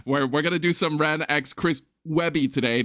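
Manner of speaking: normal talking voice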